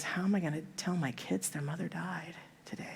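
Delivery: hushed, half-whispered despair; grieving husband. Retold or imitated. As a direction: voice sinking almost to a whisper in retold grief. Widower facing telling his children.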